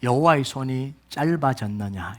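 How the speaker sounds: low, slow, hushed voice of God; quiet, deep divine question; God speaking. Acted. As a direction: ask it softly and gravely, drawn out. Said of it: "하나님이 모세에게 하신 말씀".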